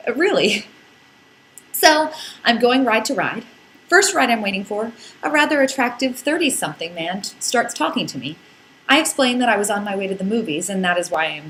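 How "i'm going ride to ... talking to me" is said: usual speaking voice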